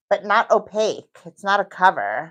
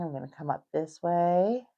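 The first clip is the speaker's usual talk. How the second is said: ordinary talk, nothing marked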